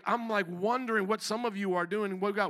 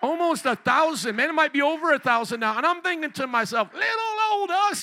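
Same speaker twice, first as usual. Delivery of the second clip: fiery call and response; 7 semitones higher, 6 dB louder than usual. Acted. Pastor leading the crowd to shout Chicago for Jesus, loud. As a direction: make them shout it back. Preaching at full force.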